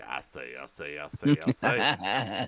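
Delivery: hammy I say, I say; Foghorn Leghorn voice. Retold or imitated. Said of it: "Thank you, Foghorn".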